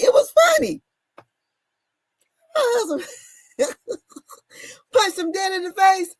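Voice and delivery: can barely get it out for laughing; laughing hard. Real, not acted. Wife telling about husband punching his cousin, laughing.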